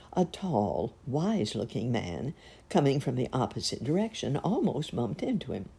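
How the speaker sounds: plain reading voice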